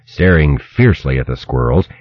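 plain reading voice

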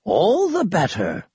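put-on slow, grand old man flourish; dramatic. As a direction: declare it with theatrical flair. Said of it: "raising a hand dramatically".

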